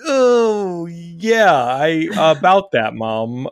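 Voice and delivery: mock-sheepish, starting high and settling; awkward hedging. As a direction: stall awkwardly before breaking it to mom. Awkwardly breaking news to mom.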